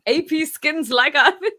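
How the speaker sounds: loud mock read-aloud, "skins" said as written; imitating typo reading. Viewer teasing the host for reading typos as written, loud mock reading. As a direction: tease him by loudly reading the typo "skins". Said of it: "He reads them".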